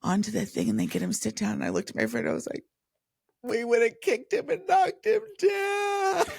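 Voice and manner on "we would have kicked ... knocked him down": high, drawn-out mock shout; hammed-up shouted confession; comic exaggerated outburst. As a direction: cry it out slowly and high, hamming it up. Acted contrast with helpful Londoners.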